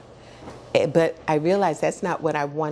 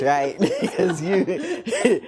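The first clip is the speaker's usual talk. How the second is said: laughing through words; much slower than usual. Retold or imitated. Quoting Cy, laughing mid-line.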